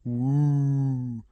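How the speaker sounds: hammed-up spooky woo; teasing ghost noise; mock ghost woo. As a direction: croon it like a cartoon ghost. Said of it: "did wavy fingers and went".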